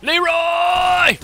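very high, loud drawn-out yell; battle cry. Acted. Player charging in game, long high battle yell. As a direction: hold the name on one high note, yelling.

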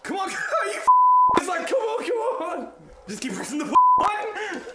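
frantic high pleading; psyching up. Real, not acted. Urging the door to open.

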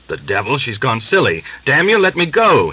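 rising into a high, loud furious splutter; old man pinned down, spluttering furious shout; spluttering anger. A narrator's reading. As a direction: sputter it out, building to a yell. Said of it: "he spluttered".

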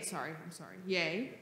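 ordinary talk, nothing marked

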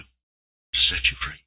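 soft, slow and tender; gentle whisper. Acted. Gentle answer to freed woman.

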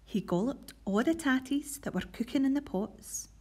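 put-on lively read-aloud storytelling for children; reading a Scots picture book to pupils; story narration. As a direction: tell it brightly to a school assembly. Teacher reads Scots story aloud.

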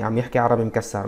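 normal talking voice

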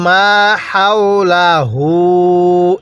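হু in হাওলাহু held for one alif; teacher recites a short one-count stretch. Shown as a demonstration. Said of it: "এক আলিফ টানতে হবে".

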